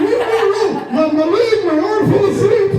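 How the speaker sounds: high, loud insistent correction; frantic loud protest. Retold or imitated. Comedian correcting the airport worker, loud flustered protest. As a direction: shout the explanation, flustered. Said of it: "I'm like".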